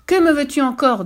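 a loud, high, angry question; angry demand. Acted. The fish angrily asking the fisherman. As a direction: demand it in fury. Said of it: "demanda le poisson en colère".

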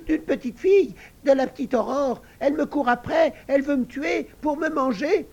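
high, loud squeaky pig voice, urgent; frightened piglet. Acted. Little pig tells the sisters Aurore wants to eat him, high and panicky. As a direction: piglet telling of the girl who hunts him.

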